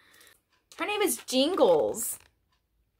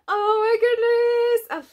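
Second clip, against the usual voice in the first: high, loud, slow thrilled cry; delighted gasp; 10 semitones higher, 8 dB louder, slower than usual. Real, not acted. Finding the wanted Triceratops, thrilled cry. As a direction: gush over the new toy.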